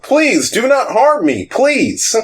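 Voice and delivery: very high, wailing plea; pleading king. Acted. Evil king begs the adventurers for mercy, pleading. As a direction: beg in a high, cowering voice. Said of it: "he just keeps his hands up".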